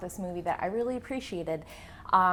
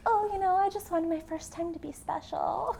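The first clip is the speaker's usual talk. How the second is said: put-on higher coy innocent girl voice; fake innocent voice; 9 semitones higher than usual. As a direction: play sweet and innocent, mockingly. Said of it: "she's like".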